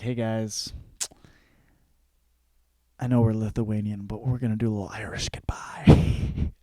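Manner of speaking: hushed, secretive whisper; sneaky whisper. Retold or imitated. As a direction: whisper it as if slipping out unnoticed.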